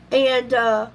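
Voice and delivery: usual speaking voice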